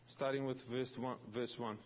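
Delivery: usual speaking voice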